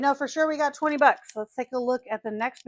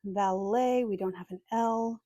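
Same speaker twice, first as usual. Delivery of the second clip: ordinary talk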